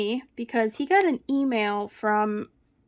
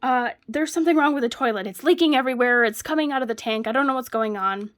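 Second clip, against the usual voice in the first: fake panic; 2 semitones higher, 3 dB louder, faster than usual. Wife luring husband in to the prank, loud faked panic. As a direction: sell the fake emergency breathlessly. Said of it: "I'm like".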